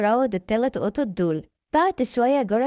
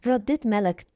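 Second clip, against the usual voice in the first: usual speaking voice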